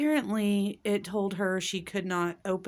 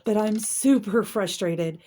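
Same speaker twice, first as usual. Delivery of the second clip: ordinary delivery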